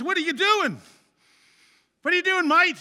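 put-on high, loud Australian accent. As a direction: call out in a put-on Aussie accent. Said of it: "there's my Australian accent".

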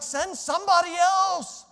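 high, slow begging whine; pleading. Retold or imitated. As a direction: beg high and slow to be spared. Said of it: "I was like".

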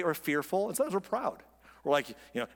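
ordinary talk

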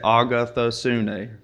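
Agathosune said slowly, syllable by syllable. Shown as a demonstration. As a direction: sound out Agathosune, a-ga-tho-su-ne, evenly. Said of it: "we can clap the syllables".

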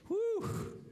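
high, loud joyful whoop; excited woo. Real, not acted. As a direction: let out a happy cheer to the church. Pastor's excited cheer.

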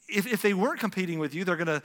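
ordinary talk

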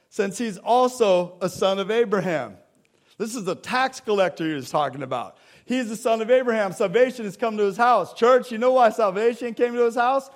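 preacher declaring salvation to the congregation; full-force preaching. Acted. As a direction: proclaim it to the whole church.

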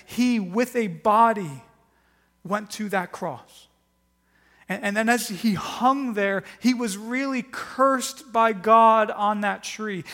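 loud, intense sermon delivery, slowing on key words; forceful preaching. Acted. Pastor to congregation on the cross, loud fervent preaching. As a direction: preach it hard, pressing the point.

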